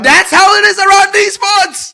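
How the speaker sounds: very high, yelled disbelief; loud shocked realisation. Retold or imitated. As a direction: play up the alarm at full volume. Acted shocked realisation of the danger.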